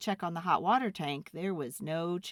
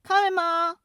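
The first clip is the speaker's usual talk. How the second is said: higher, louder shout up the stairs; calling back; 12 semitones higher, 9 dB louder than usual. Acted. Boy shouting up to his mother, loud and high. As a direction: call it up to mom.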